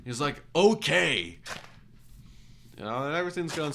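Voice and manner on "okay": loud, high mock exasperation; exasperated customer. Customer reacting to the mechanic's update, mock exasperated. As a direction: mimic an irate customer's drawn okay.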